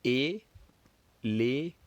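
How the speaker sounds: "Éléphant" very slowly, split into three beats; long, separated "É-lé-phant"; counting out syllables. Shown as a demonstration. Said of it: "Let's count together".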